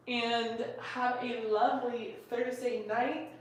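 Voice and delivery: slow, sleepy, drowsy voice; drowsy, fading, falling asleep. Real, not acted. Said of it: "Oh, I'm falling asleep".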